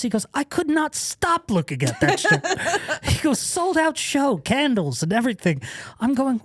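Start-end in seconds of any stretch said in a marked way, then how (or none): 0.3-2.4 very high, imitated complaint; comically appalled friend; mock exasperation. Retold friend's exasperated complaint about shirt. 3.4-5.6 high-pitched, indignant imitation; comically put-out friend; mock exasperation. Retold friend's exasperated complaint continues.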